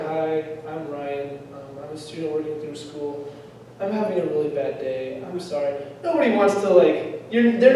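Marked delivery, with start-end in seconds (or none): 0.1-5.7 mopey salesman. Retold or imitated. Student at the door, mock miserable and much softer. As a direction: sound like you've given up on the day. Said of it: "you're sounding like".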